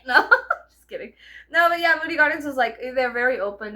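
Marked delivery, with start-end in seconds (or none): none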